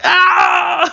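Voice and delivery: high mock scream; retold scream. Remembering the ghost boy scene, high mock scream. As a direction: yelp like the movie got you. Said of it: "And I was like".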